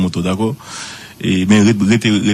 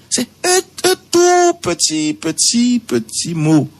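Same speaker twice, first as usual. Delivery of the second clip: very high, drawn-out insistence on the tiny word; emphase aiguë; slower than usual. Shown as a demonstration. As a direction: raise the voice way up, stretching "petit, petit". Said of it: "repeating tiny word". (voix très aiguë, insistante)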